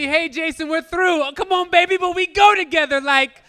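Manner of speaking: high, loud, pleading sing-song; mock girlfriend breakup. Imagined girlfriend dumping Jason in doo-wop, high pleading.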